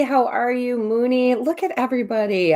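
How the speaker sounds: ordinary delivery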